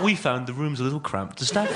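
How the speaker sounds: prim Middle England complaint voice; Middle England accent. Acted. Comic reading out a negative TripAdvisor review, Middle England accent. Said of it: "in that wee internal Middle England voice".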